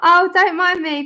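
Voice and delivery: laughing. Real, not acted. Laughing at herself.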